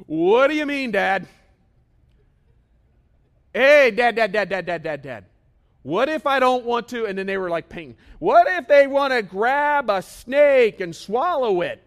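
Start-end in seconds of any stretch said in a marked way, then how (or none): 0.1-7.1 put-on loud, high whiny kid voices calling Dad; pestering kids. Preacher voicing his kids objecting to his plan, loud and whiny. 8.2-11.8 put-on loud, high, slow childish protest; whiny kid objection. Preacher voicing his kids' wild scenario, loud and high. Kids raising extreme objections.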